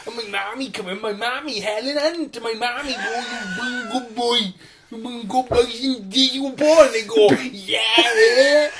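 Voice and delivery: put-on high, daft mummy's boy; childish film dreamer. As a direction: play a proud little simpleton. Deluded patient narrates his film.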